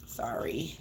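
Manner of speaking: soft, rising fake "Sorry." barely voiced; hushed insincere "Sorry." with a lift at the end; mock apology. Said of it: "Not sorry".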